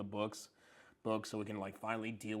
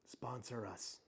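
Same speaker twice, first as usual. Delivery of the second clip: whisper; 8 dB quieter, slower than usual. Acted. Joking plea to sponsors.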